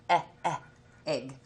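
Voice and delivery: "eh, eh, egg" with a smiling short e, spread lips; short e with a smile. Shown as a demonstration. Teacher modelling the short e sound for young readers, smiling. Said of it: "You kinda smile when you say E".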